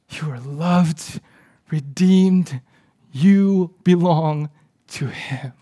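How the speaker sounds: slow, weighty sermon delivery with long pauses; heartfelt preaching. Real, not acted. Pastor urges church to affirm young people, slow heartfelt preaching.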